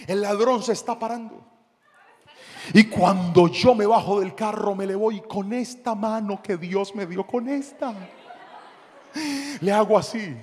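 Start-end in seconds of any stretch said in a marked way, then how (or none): none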